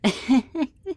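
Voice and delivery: high, loud, rising laugh; bubbling high laugh at a victim; teasing giggle. Acted. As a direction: giggle at the scare you just gave. Teasing captive with fake threats.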